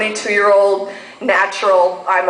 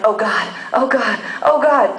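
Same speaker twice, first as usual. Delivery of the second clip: mock moans. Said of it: "two syllables".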